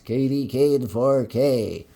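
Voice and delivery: slow announcer read; drawn-out announcer delivery; radio announcer voice. Pretend. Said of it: "like a radio announcer?".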